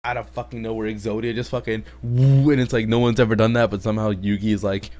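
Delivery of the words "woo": mock whoosh. Exodia appears.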